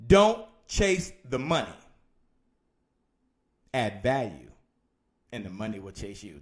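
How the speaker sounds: slow, spaced phrases with long pauses. Shown as a demonstration. Coach repeating a saying for note-takers, slow and deliberate. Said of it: "say it again a little bit slower".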